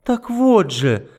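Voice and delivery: high, slow eager pointing out; a desperate flash of hope; hopeful. Acted. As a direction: seize on a hope. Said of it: "с надеждой ткнула".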